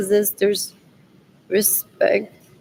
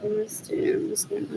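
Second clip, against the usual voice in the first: whisper; 12 semitones higher, 8 dB quieter than usual. Real, not acted. Whispered aside.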